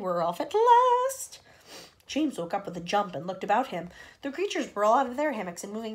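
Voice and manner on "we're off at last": high, slow, loud triumphant cry; squealing celebration; excited high shout. A narrator's reading. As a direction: stretch it out in delight. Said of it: "someone was shouting".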